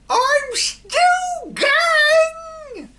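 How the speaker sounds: screeching hag, very high and loud; old hag screeching from above; old screechy voice. A narrator's reading. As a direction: shriek it like a gleeful witch. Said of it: "the old screechy voice".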